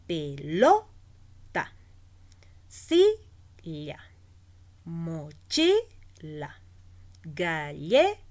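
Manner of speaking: loud LO, SI, CHI, LLE in Pelota, silla, mochila, galleta, then a gap; stress on penultimate syllable. Shown as a demonstration. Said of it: "se pronuncia en la penúltima sílaba". (penúltima sílaba fuerte y alargada en Pelota, silla, mochila, galleta)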